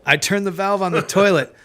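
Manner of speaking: ordinary delivery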